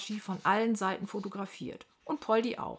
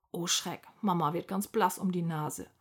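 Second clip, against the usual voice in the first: usual speaking voice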